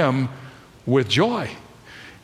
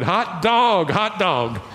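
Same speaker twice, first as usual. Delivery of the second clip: higher-pitched, jubilant mock shout; retold joyful shout; 5 semitones higher, much slower than usual. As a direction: act out his overjoyed outburst, voice lifted. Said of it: "threw both hands in the air, and shouted".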